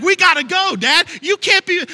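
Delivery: put-on high, loud, nagging child's yell; impatient kid shout. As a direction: push Dad out the door. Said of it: "He's like".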